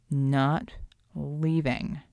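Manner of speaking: slow, low, sulky; stubborn refusal. Acted. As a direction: drag it out like a pouting man. Klaus refuses from bed.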